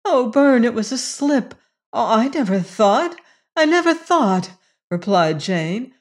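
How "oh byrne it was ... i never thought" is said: high, remorseful protest; upset, apologetic cry; distressed pleading. A narrator's reading. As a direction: plead your excuse in a raised pitch. Jane pleads after being accused.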